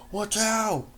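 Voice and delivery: loud, high urgent shout; yelled warning. Acted. Narrator yelling to the frozen mare, loud and urgent. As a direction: shout to save her.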